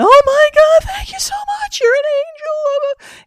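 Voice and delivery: very high, wailing tearful thanks; hammed-up sobbing gratitude; bursting into tears. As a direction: cry out your thanks in a squeaky wail. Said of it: "she bursts into tears".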